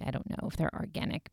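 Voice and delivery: soft breathy whisper, imitating Jay; whispered quote. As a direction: hush it like a muttered aside.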